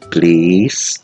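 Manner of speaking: ordinary talk, nothing marked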